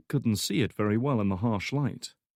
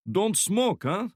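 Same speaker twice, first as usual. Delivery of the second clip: put-on high, loud thick Slavic accent; heavy Slavic accent; 12 semitones higher, 4 dB louder, slower than usual. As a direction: Slavic thug voice, friendly menace. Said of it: "speaking in a heavy Slavic accent".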